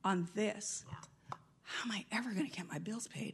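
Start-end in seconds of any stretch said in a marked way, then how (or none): 1.6-3.3 softer, fast worried whisper; anxious mutter. Acted. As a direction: mutter the worry to yourself. Mimicking anxious self-talk.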